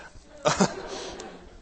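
breathy, high laugh at himself; laughing at the memory; breathy laugh trailing down; real laugh. Real, not acted. Laughs again.

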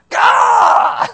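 sudden high, loud "gah" yell; exasperated scream. Acted. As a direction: explode in a hammed-up shriek of rage. Acts exploding anger in a fight.

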